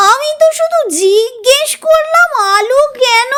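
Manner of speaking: put-on high whiny child protest; tearful protest. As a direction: plead your innocence, high. (কাঁদো কাঁদো প্রতিবাদ)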